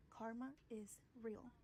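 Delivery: slowed down, each word weighted; a deliberate, drawn-out warning; slow emphatic. Real, not acted. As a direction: lay it down slowly, like a verdict. Repeats slogan, second slower.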